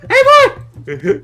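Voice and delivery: loud, high squealing cries; excited Eevee yelling. Pretend. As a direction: yell like an overexcited Eevee. Excited Eevee calls, laughing.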